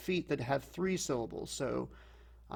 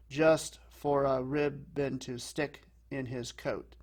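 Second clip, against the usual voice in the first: slow beat, heavy "Just", "ribbon", "stick", "coat"; DUM-da-da scansion, leaning on the first syllable of "ribbon"; dactylic stress; slower than usual. Shown as a demonstration. Teacher showing stressed and then two unstressed, dactylic beat from "Just" to "coat". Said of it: "patterns of stressed and then two unstressed".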